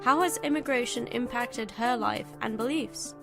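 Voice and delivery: normal talking voice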